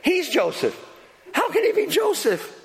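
high, disbelieving stammer with a laugh; a light mock of the brothers' disbelief; stunned brothers. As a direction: voice the brothers' shock as it dawns. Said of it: "the first thought running through their minds is".

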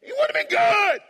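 very high, loud, gleeful holler; excited shout. Acted. Preacher imagining parents thrilled with a son, excited shout. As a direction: shout it at the top of your range.

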